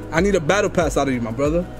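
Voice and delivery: usual speaking voice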